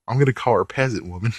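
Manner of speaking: ordinary talk, nothing marked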